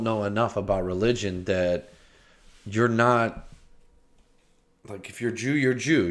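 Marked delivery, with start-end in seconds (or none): none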